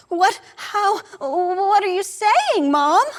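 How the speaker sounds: high, slow, stunned protest; stunned son asking his mother; stunned disbelief. Acted. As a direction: voice jumps up in shock.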